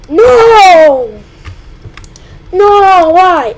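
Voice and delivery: loud, high cry of dismay; dismayed shout. Real, not acted. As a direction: yell as the game kills you. Dies in game.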